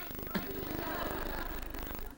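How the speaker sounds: breathy chuckle, barely voiced; soft airy laugh. Real, not acted. Speaker finishing the blouse story, soft airy laugh. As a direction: laugh quietly at the daughter's retort. Laughing after daughter's punchline.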